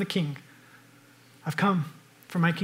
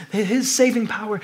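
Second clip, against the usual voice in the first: ordinary talk, nothing marked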